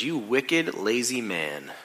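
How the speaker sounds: a quiet, cutting judgment; boss's soft, icy verdict; cold rebuke. Acted. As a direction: drop the voice and condemn him. Buffett scolds the lazy employee.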